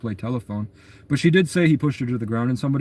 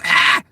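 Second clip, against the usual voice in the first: short high loud yelp in someone's face; imitated yell; 8 semitones higher, 7 dB louder than usual. Retelling the boyfriend walking up and yelling in his face. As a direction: throw out a sudden loud yell. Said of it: "I just yelled at you".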